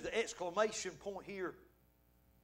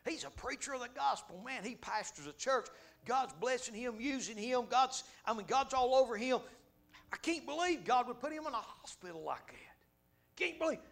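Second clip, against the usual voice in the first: mock disbelief; 7 semitones higher than usual. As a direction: imitate folks who can't believe it. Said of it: "you know what they would have said".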